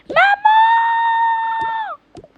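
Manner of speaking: high, drawn-out yell for mom; shouted call. Acted. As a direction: scream for mom in panic. Child calls mother in panic.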